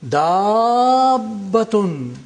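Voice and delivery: "Dabbatun" slowly, as daaaab-batun; extra lengthening. Shown as a demonstration. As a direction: stretch the first a of "Dabbatun" very long into the doubled b. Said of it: "are followed by a sakin letter".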